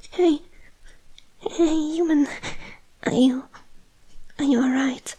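high, anxious whisper; whisper, afraid he's hurt; worried whisper. Acted. Checking on fallen human anxiously.

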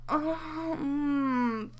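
drawn-out moan melting into a hum; pleasured moan. Acted. Speaker reacting to a lover, moaning. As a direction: sigh out a long moan.

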